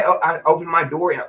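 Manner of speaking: ordinary talk, nothing marked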